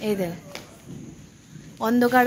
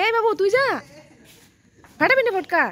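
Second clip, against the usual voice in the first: calling out; 10 semitones higher, 5 dB louder than usual. Real, not acted. Calling out to the kids.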